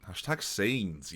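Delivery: ordinary talk, nothing marked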